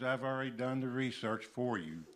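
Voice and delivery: normal talking voice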